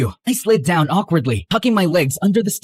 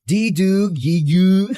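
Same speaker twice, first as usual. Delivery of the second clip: put-on baby talk; 3 semitones higher, 3 dB louder, slower than usual. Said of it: "Sounds like a baby trying to talk".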